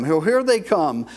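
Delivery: ordinary talk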